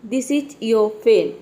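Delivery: very slow, each word laid out, your with faint r; slow model reading of This is your pen; slow demo sentence. Shown as a demonstration. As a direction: read it out slowly for students. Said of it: "इस तरह से".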